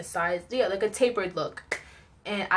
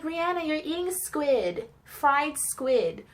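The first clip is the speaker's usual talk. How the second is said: know-it-all classmate; 7 semitones higher, much slower than usual. Retold or imitated. Smart girl voiced in story.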